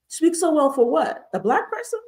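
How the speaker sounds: high-pitched disbelief with a laugh; laughing, mock-baffled challenge; incredulous comeback. Acts incredulous comeback to compliment.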